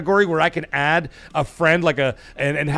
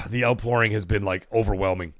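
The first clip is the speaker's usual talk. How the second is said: softer, emotional voice close to tears; choked up; 6 semitones lower, 4 dB quieter than usual. Real, not acted. Streamer thanking viewers for support, choked up. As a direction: struggle to get the words out. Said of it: "I can't even speak anymore".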